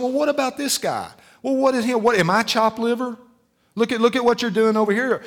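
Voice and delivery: high, whining mock complaint; whiny complainer. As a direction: act the jealous believer, whining. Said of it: "We start saying".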